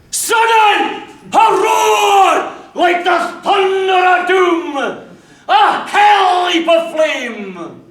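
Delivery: high, loud, thundering explosion; a sudden shouted climax; booming narration. A narrator's reading. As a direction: roar it out like the blast itself, slowing at the end.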